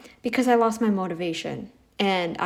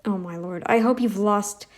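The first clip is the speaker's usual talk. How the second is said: ordinary talk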